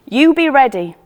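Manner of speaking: loud firm call up. A narrator's reading. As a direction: shout a cheerful order upward. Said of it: "says Betty".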